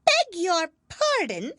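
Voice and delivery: high, slow, stiffly polite mouse voice; icy courtesy from a small mouse; frowning but polite. A narrator's reading. Mouse to the lory, frowning yet polite. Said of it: "frowning, but very politely".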